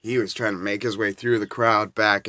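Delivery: ordinary talk, nothing marked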